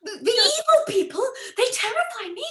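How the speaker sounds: high, loud, frightened wail; terrified. Acted. Frightened woman fearing evil people, high terrified cry. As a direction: shriek it out in terror.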